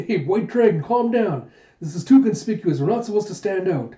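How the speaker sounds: flustered protest. Acted. Jayha being carried off.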